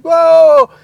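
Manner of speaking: loud high whoa; amazed whoa. Retold or imitated. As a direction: gasp it in wonder. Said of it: "he's like".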